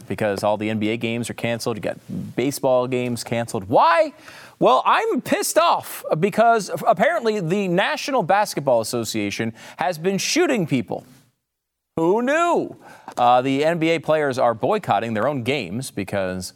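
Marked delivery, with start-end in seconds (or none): 3.6-12.8 sarcastic mock outrage. Host ranting at the NBA.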